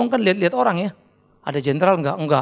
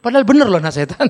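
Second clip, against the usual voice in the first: usual speaking voice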